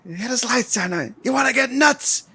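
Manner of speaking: high, manic movie-hero imitation; Beetlejuice-like outburst. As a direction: act out a sudden unhinged challenge. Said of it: "he kinda gets a little Beetlejuice thing going on".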